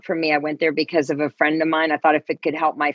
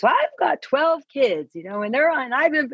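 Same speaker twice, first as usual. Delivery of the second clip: lady's voice; 9 semitones higher than usual. Retold or imitated. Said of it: "and this lady goes".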